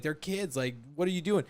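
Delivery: ordinary delivery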